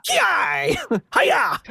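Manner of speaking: samurai shout. Retold or imitated. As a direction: squeal it like a cartoon samurai. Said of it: "he kept the noise the same".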